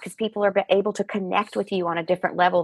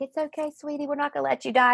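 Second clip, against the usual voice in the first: gentle reassurance from the ambulance lady; soothing nurse; 8 semitones higher, faster than usual. Retold or imitated. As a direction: soothe her like a scared child. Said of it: "she's stroking my head".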